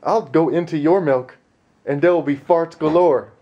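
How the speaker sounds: high, loud hammed-up scolding; wife told off in a high, loud mock scold; mock stern husband. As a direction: ham up a bossy threat.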